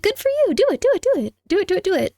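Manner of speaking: high, eager chant; excited cheering. Real, not acted. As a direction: cheer them on, bouncy and high. Cheering a chatter on.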